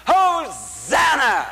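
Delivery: long, high, drawn-out Hosanna cry; cried out Hosanna. Shown as a demonstration. Preacher re-enacting the crowd crying out to Jesus, long high shout. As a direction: call it out over the crowd. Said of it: "They cried out".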